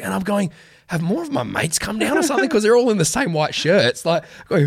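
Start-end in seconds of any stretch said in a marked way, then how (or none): 0.9-2.5 high, puzzled disbelief; baffled, pitched way up; confused. Retold or imitated. Confused at the crowd.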